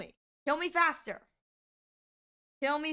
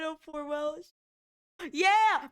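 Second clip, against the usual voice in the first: ordinary talk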